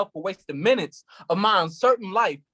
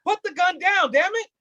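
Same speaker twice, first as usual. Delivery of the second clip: higher, sharp order; urgent command; 7 semitones higher than usual. Acted. Cop shouting at the man to drop his gun. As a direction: push the words out fast to stop him.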